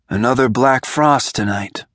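ordinary delivery

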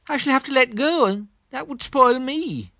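high, earnest little bear voice; a thoughtful teddy bear making a decision; Pooh voice. Acted. Pooh voice in the story.

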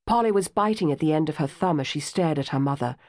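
usual speaking voice

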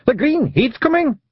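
high broad Scots voice; a wee pixie barking in Scots; tiny Scottish man. A narrator's reading. First wee man hauling his friend aboard, high broad Scots. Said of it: "said the first man".

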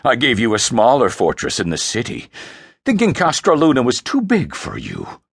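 scornful, deliberate dressing-down; dripping disgust. Acted. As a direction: berate your son with disgust, building in force. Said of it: "Frey's voice dripped disgust".

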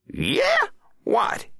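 very high, sharp presidential query; testy president. A narrator's reading. President answering the Attorney General, high and testy. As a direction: shoot back questions in a high voice. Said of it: "asked C C".

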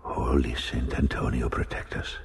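a scared captain begging a saint under his breath; frightened plea. Acted. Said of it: "exclaimed the captain in a fright".

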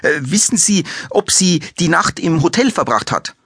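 hectic follow-up. A narrator's reading. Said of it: "schob Kastner hektisch hinterher".

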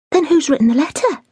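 a hint of a curious young girl; young maid pressing the housekeeper for news; eager young maid. Daisy voiced in reading.

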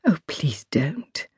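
whispered, slow plea; soft please. Acted. Princess stopping Zoe's curtsy, slow whisper. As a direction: hush her out of the curtsy gently. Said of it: "said the princess".

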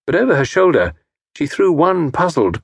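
usual speaking voice

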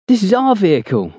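forceful. Acted. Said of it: "Nick adds forcefully".

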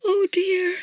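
high-pitched "oh dear" exclamation. A narrator's reading. Missus Claus finally seeing Santa, exclaiming. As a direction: exclaim it high, on finding him. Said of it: "exclaimed Missus Claus".

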